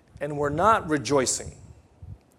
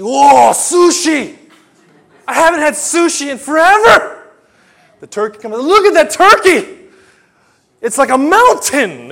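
much higher and louder, slow and gushing; thrilled beggar; 12 semitones higher, 13 dB louder than usual. Retold or imitated. Poor guest seeing the feast, overjoyed and loud. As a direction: gasp with delight at every dish.

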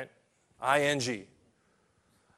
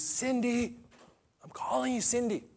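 high gentle call; 7 semitones higher, 5 dB quieter than usual. Acted. Jesus calling teenage Cindy.

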